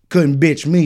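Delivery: ordinary delivery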